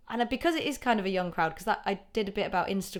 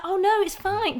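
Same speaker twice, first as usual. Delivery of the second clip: higher, louder, brushing it off; mock breezy reassurance; 13 semitones higher, 8 dB louder, slower than usual. Comedian on stage after a groan, mock breezy. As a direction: fake it's-fine cheer. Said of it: "And I was like".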